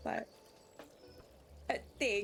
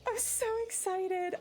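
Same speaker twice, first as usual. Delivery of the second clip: high, slow, stretched squeal of joy; squealing excitement; 10 semitones higher than usual. Real, not acted. Squealing over GTA reveal.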